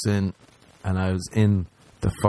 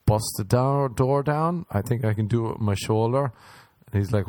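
usual speaking voice

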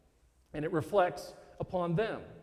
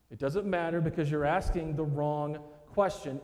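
normal talking voice

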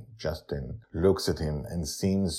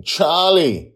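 loud, high call of the name; urgent call; 12 semitones higher, 10 dB louder than usual. A narrator's reading. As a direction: call out to the boy in alarm. Father calls out to boy.

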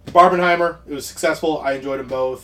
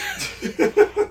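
high laugh. Real, not acted. Laughs at his own verdict.

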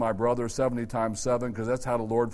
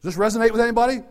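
quick bright ask; 9 semitones higher, 6 dB louder, much faster than usual. Real, not acted.

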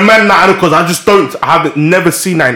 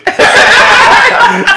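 high laugh; 3 dB louder than usual. Real, not acted. Bursts out laughing.

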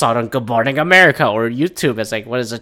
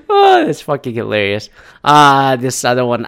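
ordinary talk, nothing marked

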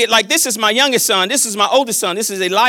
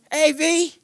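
sweet high-pitched greeting; calling a grandchild; 7 semitones higher than usual. Real, not acted. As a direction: greet your small grandchild in a lifted voice. Calling to his small grandson.

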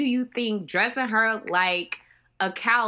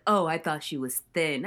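lower, offhand quote of the film characters; a hint of a dismissive Brit; snooty character; 8 semitones lower, 3 dB quieter than usual. Characters remarking on Bridget's weight, low offhand. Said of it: "they were like".

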